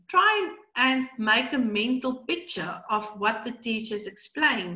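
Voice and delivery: ordinary talk, nothing marked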